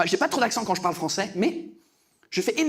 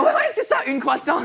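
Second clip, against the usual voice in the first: mocking dismissal; 7 semitones higher than usual. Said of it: "elle s'en foutait complètement".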